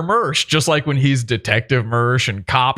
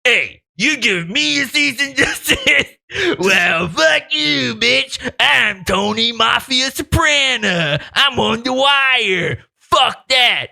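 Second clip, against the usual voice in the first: mocking tough guy; 9 semitones higher, slower than usual. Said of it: "he's like".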